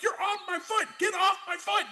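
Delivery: very high mock shouting; high-pitched hammed-up urgency; retold pained protest. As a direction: imitate a friend frantic to free a foot. Retells friend pushing car in pain.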